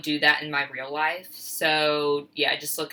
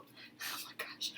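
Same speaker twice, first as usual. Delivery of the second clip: startled whisper; 22 dB quieter than usual. Real, not acted. Startled by splash while pouring.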